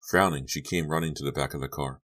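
usual speaking voice

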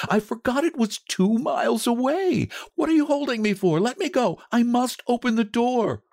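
put-on high, urgent pleading of an old woman; frantic cry. A narrator's reading. Mother held back from the door, frantic cry. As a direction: plead high and desperate, struggling to break free. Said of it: "she cried, struggling mechanically".

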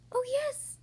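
very high, eager little voice; piping tiny voice. A narrator's reading. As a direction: pipe up high as someone tiny. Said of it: "piped the voice".

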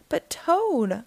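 high, pleading protest; mild cry of dismay. A narrator's reading. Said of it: "cried Frog".

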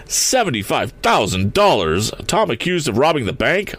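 faltering. A narrator's reading. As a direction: falter in mild disbelief. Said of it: "faltered Mister Swift".

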